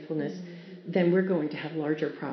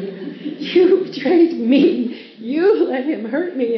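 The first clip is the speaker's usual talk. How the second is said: put-on betrayed baby; 6 semitones higher, 9 dB louder, much slower than usual. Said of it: "he looked at me with such betrayal".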